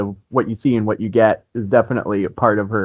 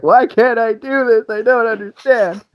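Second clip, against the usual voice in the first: hammed-up helpless frustration, pitched way up; mock frustration; 12 semitones higher than usual. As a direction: whine it like you're banging your head on a wall. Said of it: "bashing my head against the wall".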